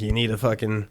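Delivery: ordinary talk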